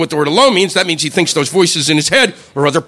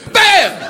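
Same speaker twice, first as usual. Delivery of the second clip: high, sharp "bam"; gunshot bang; 13 semitones higher than usual. Acted. Comic telling of rifles backfiring, high-pitched bang. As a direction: fire it off as one sudden bang.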